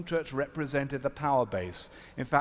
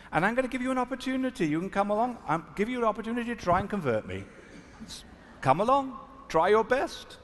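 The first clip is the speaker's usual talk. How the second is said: playful challenge; 9 semitones higher than usual. Retold or imitated. Teasing the minister to convert him.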